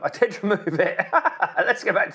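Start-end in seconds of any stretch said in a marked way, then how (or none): 0.0-1.0 laughing. Real, not acted. Admits own mistake, laughs. 1.0-1.5 a quick, much higher embarrassed laugh; short laugh. Real, not acted. Laughs at own mistake.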